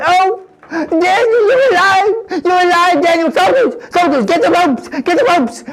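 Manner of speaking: high, loud tearful cry of joy; overjoyed king calling for his soldiers. Acted. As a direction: shout it out, weeping with relief. King finds Daniel alive.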